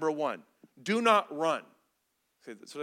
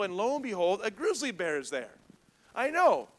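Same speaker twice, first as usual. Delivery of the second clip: ordinary talk, nothing marked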